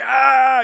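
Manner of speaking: high, loud mock-panicked "Ah"; mock excited yelp. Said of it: "here I am all, hypersensitivity".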